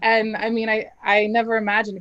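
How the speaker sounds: ordinary delivery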